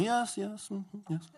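high, soft nodding yes; a lightly voiced guide nodding along; imitating Miss Ling. Comic tells of Miss Ling nodding, lightly imitated. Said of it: "Hun bare pekte og bare".

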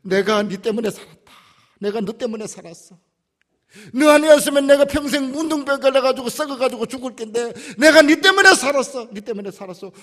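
rising from loud to a near shouted, tearful cry; a general crying out thanks to the servant girl, loud and tearful; weeping gratitude. Retold or imitated. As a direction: pour out thanks as if sobbing. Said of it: "울면서".

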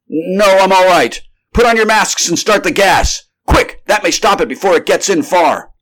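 high, loud commands; urgent orders. A narrator's reading. Doctor ordering gas masks and gas, urgent shouting. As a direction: shout instructions in an emergency.

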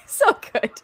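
high laughing squeal. Real, not acted. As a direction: squeal it through a giggle. Laughing after joke.

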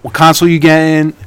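tough guy voice; gangster voice. Acted. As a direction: act all hard, like a gangster. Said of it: "Why'd you go all gangster".

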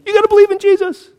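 high, loud mock proclamation; pushy gospel pusher. Zealot getting gospel points in, mock high-pitched shouting. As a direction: hammer it out in a put-on high voice. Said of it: "I need to get my gospel points in".

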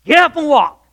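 loud, high shouted command; commanding call. Acted. Preacher acting out ordering a sick man to rise, commanding shout. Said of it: "and I said".

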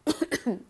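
sudden dry cough; coughing fit. Real, not acted. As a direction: cough mid-sentence. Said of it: "que tosse".

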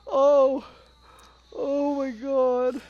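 gasping, breathless after laughing; wheezy voice. Real, not acted. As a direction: wheeze it out, laughing too hard. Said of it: "I can't fucking breathe".

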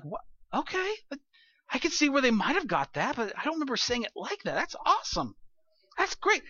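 mock confusion turning to fake cheer; bewildered inner thought. Pastor's private reaction to congregant's praise, mock bewildered. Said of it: "But I'm thinking in my head".

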